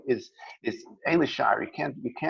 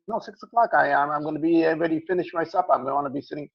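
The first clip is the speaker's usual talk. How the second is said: higher-pitched, incredulous protest; indignant objection; 5 semitones higher, 4 dB louder than usual. Retold or imitated. Customer protesting to the butcher about coming back later, indignant. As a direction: push back like it's absurd.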